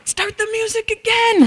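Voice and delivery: high, loud, slow call; urgent call. Retold or imitated. Family rushing to restart music, high and loud urgent call. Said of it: "we had to go".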